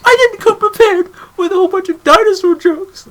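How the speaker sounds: high, loud bad Miss Piggy. Shown as a demonstration. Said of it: "Oh, that was awful".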